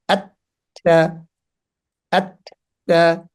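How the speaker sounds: আত cut off with no air, pause, then তা; sound stopped, then released. Shown as a demonstration. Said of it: "যখন connect করব তখন বাতাসটা হবে না".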